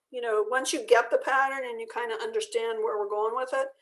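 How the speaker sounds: ordinary talk, nothing marked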